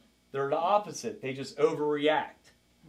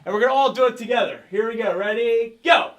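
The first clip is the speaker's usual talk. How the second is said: fast and louder, rallying the class; 3 semitones higher, 6 dB louder than usual. Real, not acted. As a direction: get the whole room moving.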